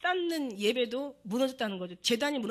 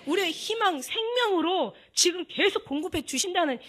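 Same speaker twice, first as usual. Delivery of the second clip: louder, higher impassioned preaching; preaching at full force; 4 semitones higher, 5 dB louder than usual. Real, not acted. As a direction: drive the point home to the congregation. (열정적으로 외치는 설교)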